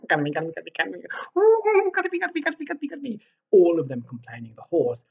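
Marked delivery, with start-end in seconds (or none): 0.1-3.2 high monkey squeals of garmy; squealing monkeys. Acted. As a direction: shriek it like a pack of hot monkeys. Monkeys complaining.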